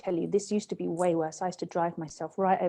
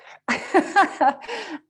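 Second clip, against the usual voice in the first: laugh; 10 semitones higher, 5 dB louder than usual. Real, not acted. Laughing at her own retold outburst, a loud laugh. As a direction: burst of high, loud laughter.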